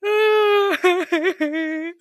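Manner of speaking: loud, high comic bawling; exaggerated sob-wail, long and loud; mock wailing. She bawls after he dries her clothes, mock wailing. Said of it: "I cried even harder".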